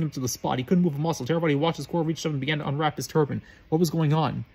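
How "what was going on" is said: usual speaking voice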